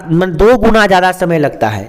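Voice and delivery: usual speaking voice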